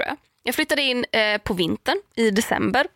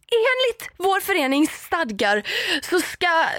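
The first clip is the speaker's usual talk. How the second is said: mock officious neighbour; 9 semitones higher, slower than usual. Neighbour quoting rules.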